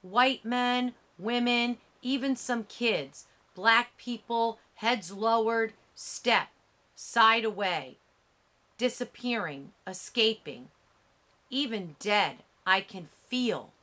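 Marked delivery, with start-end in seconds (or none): none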